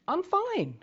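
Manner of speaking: high-pitched "no problem" act; mock-cheery dismissal of concern; brushing off help. Acting someone who refuses needed help.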